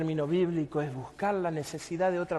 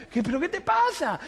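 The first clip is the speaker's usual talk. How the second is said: high-pitched, urgent retold question; alarmed question; 9 semitones higher than usual. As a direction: ask in acted alarm. Retelling his alarm at Dani crying. (pregunta alarmada)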